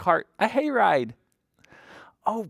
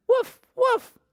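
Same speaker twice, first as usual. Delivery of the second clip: very high little woofs; puppy bark; 4 dB louder than usual. A narrator's reading. Biscuit barks at the baby chicks, high yapping woofs. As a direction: yap like a small puppy.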